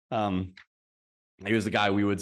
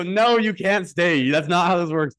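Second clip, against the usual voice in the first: loud, high, drawn-out retold refusal; raised voice, stretching out the firm no; exasperated parents; 6 semitones higher, 6 dB louder, slower than usual. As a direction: imitate parents putting their foot down. Exasperated parents refusing the boy's plea.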